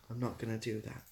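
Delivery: ordinary delivery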